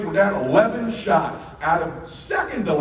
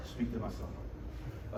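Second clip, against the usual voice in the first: threatening whisper; 4 semitones lower, 14 dB quieter, slower than usual. Retold or imitated. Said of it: "say it how I really said it".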